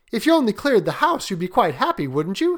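lightly voiced coaxing son; Herbert. Son nudging his father toward a wish, gently coaxing. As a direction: lift the pitch and coax.

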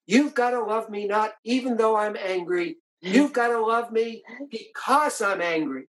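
husband imitating his wife's mild angry insistence; retold angry tone. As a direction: mimic a wife insisting, a touch heated. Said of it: "she said to me in an angry tone".

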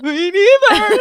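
high, loud hammed-up crying whine; mock wail. Telling boyfriend on phone she doesn't know, mock sob. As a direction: wail it like a weepy mess. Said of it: "And I'm like".

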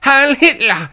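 high, loud mock dictator cry; mock Hitler. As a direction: imitate a shouted Nazi salute. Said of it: "there's Hitler going".

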